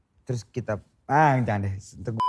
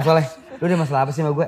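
rushed mock confrontation; much faster than usual.

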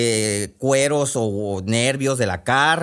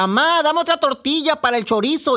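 much higher, louder call to mom; sleep shouting girl; 14 semitones higher, 3 dB louder than usual. Acted. Girl shouting in her sleep for another tortilla, loud. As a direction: beg for food in her sleep. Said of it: "volvía a gritar la Chu".

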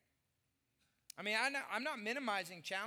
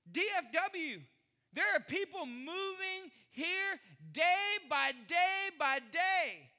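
preaching; 11 semitones higher, 7 dB louder than usual. Acted. Pastor preaching gratitude to the church, loud and high. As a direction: preach it out to the congregation.

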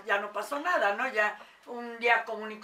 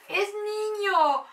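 slow, high, drawn-out wonder; amazed exclamation; 9 semitones higher, 5 dB louder, much slower than usual. Retold or imitated. Meditation instructor announcing the baby is a boy, slow and awed. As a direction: stretch it out in awe, pitched high and loud. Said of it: "Me dice".

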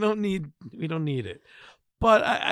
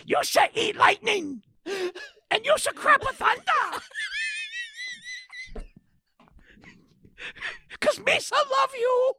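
mock gruff trainer. Mock Mickey trainer quote.

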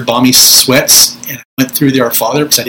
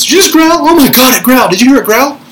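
much higher and louder panicky ghost-hunter voice; Zak Bagans; 11 semitones higher, 4 dB louder than usual. Retold or imitated. Mocking a ghost hunter startled by a growl, high panicky squeal. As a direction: squeal in excited alarm at a noise. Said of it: "don't do like Zach Bagans".

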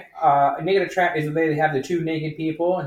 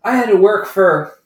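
higher and louder, slurring tipsy rant; drunk woman impression; 6 semitones higher, 8 dB louder than usual. Drunk customer complaining about her old boss, loud tipsy rant. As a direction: lurch through it like a drunk ranting.

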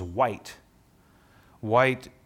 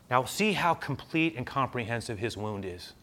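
usual speaking voice

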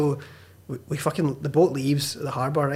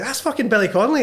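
higher and louder surprised exclaim; excited crowd; 8 semitones higher, 4 dB louder than usual. Retold or imitated. Surprised pub crowd spots the famous comedian, excited and higher. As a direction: gasp it out, starstruck. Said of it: "and everyone's like".

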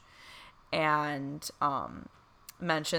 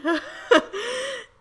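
teary laugh. Real, not acted. Emotional laugh mid-story.

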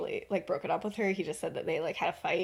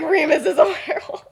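laughing blurt; 8 semitones higher, 10 dB louder than usual. Retold or imitated.

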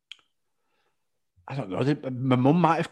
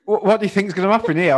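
exasperated teacher; 5 semitones higher, 5 dB louder than usual. Retold or imitated. Teacher scolding.

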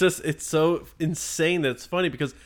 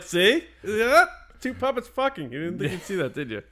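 teasing wife; 7 semitones higher than usual. Retold or imitated. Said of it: "I'm like".

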